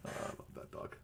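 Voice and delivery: breathy fond whisper. Real, not acted. Owner admiring his dog off to the side, fond whisper. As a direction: say it to yourself, barely voiced.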